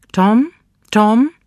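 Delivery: high anxious call across the house. Acted. Mother calling for her son, high and loud.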